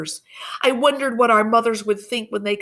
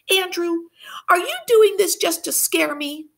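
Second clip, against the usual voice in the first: put-on little girl voice; 12 semitones higher, 3 dB louder than usual. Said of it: "said Marie".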